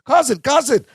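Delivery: put-on high, excited Balki accent; high, fast lowercase complaint; Balki impression. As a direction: whine it up high like a fussy cousin. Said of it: "Balki goes".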